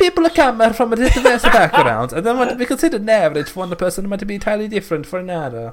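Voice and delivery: high, loud voice breaking into laughter; can't hold the new accent for laughing; laughing through an accent change. Acted.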